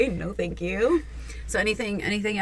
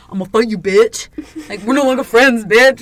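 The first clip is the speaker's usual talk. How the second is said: mock tough threat; 7 dB louder than usual. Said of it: "everybody's like".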